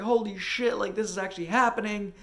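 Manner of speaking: high, loud retold excitement; ecstatic disbelief. Jumping at partner as they get home, ecstatic disbelief. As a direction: burst with joy that it's real.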